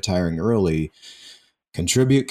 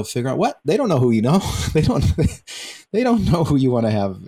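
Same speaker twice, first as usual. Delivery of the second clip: higher, half-laughing disbelief; mock incredulous retort; 6 semitones higher, 3 dB louder, faster than usual. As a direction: throw it back in amused, exaggerated disbelief. Mock retort to the quoted people.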